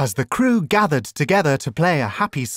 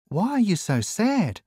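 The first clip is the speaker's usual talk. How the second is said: higher, gently concerned question; 7 semitones higher than usual. A narrator's reading. Dashi asking the gloomy little fish, concerned. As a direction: ask with tender concern, pitched up. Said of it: "Dashi asked with concern".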